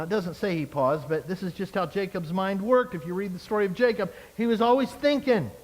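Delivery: ordinary talk, nothing marked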